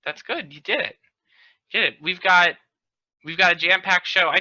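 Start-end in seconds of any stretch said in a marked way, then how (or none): none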